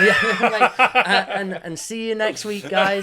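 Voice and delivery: loud, high, laughing through a fake outro; joking goodbye to listeners, laughing loudly; laughing mock sign-off. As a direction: wrap up the show as a joke, cracking up.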